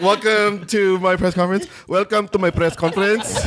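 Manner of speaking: hammed-up translator; mock translator. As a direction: echo it grandly like a fake interpreter. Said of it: "translator would be like".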